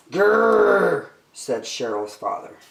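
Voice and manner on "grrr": loud, drawn-out "grrr"; frustrated growl. Acted. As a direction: growl like a thwarted dad.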